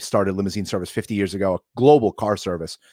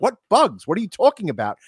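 put-on much higher, baffled young voice; kids' confusion; 10 semitones higher than usual. Boys on the set, baffled by the director. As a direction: squeak it out like a puzzled kid. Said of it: "And we're like".

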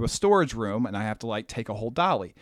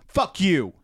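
snapped fuck you; 5 semitones higher than usual. Retold or imitated. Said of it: "he snaps a fuck you".

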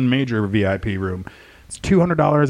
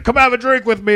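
pushy customer; 11 semitones higher, 6 dB louder than usual. Retold or imitated. Customer urging a drink.

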